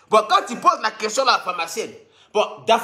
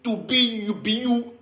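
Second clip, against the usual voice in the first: mock English gibberish; 3 dB quieter, slower than usual.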